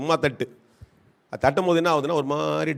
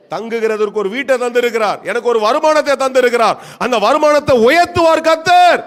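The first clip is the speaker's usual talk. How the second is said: building from raised voice to a loud high cry; swelling proclamation of faith; preached declaration; 7 semitones higher, 9 dB louder than usual. Acted. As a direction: declare it for the congregation to repeat. Leads congregation in declaration.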